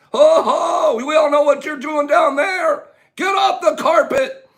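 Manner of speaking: high, loud playful call-out; hammed-up busting of the culprits; mock scolding. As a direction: pretend to catch them in the act. Mock scolding of carpet creepers.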